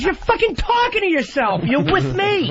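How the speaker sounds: high, mocking yell at a friend; imitated yell. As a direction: bark it out like an exasperated buddy. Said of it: "He goes".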